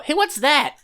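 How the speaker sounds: high, loud in-character question; sudden high-pitched exclamation; startled call-out. Acted. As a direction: call out as you point at something odd. Character calls out, pointing at corpse.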